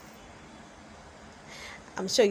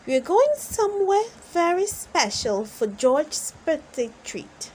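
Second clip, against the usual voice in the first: Mommy Pig voice; 8 semitones higher, 4 dB louder than usual. A narrator's reading. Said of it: "says Mommy Pig".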